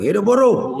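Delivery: shouted rally slogan, high and loud; fiery revolutionary speech. Real, not acted. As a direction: rouse the crowd with a chant. Rally chant closing press statement.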